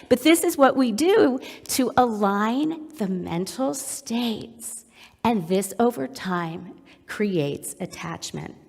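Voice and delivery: ordinary talk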